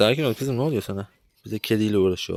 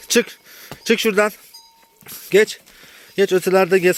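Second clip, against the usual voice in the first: high, loud shooing orders; shouted commands; 8 semitones higher, 4 dB louder than usual. Real, not acted. Farmer shooing a little calf away, loud shouted commands.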